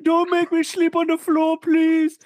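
very high put-on begging voice; mock pleading. Pretending to be the helper begging off the floor, mock pleading. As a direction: beg in a hammed-up high voice. Mocks Sergio pleading with his keepers.

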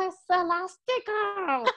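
high, slow drawn-out designer diva; Edna Moda voice. Retold or imitated. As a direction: stretch out Elastigirl like Edna Moda. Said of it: "Edna Moda si tú eres Elastigirl".

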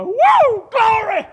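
high, loud joyful whoop; church shout. Retold or imitated. Old deacon in church hollering, a high loud whoop. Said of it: "a deacon started hollering".

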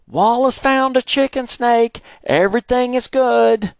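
high, loud reassuring call; yelled back. Acted. Father yelling back to neighbour reassuringly. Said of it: "Daddy yelled back".

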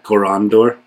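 "Corondor?" stressed on the middle syllable, rising at the end; trying out the stress. Shown as a demonstration. Checking the name's stress with the expert, stress on the middle syllable. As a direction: say "Corondor?" with the weight on "ron", asking. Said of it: "it would be emphasized like that".

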